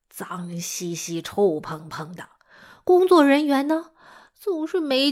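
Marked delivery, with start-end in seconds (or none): 0.1-2.3 disgusted whisper. Acted. Describing filthy old fairgrounds.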